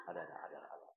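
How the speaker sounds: breathy whispered muttering to oneself; hushed self-talk whisper. Retold or imitated. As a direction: whisper it as if to an invisible listener. Mimics a person talking to himself.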